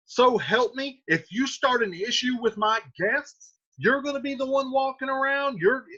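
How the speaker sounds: loud, high, indignant scolding; the uncle telling off the boy; angry paraphrase of Capulet. Retold or imitated. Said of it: "So he's like".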